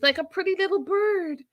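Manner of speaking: high, cutesy teasing; mock cooing. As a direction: coo it as if over a tiny bird. Mocking her as fragile bird.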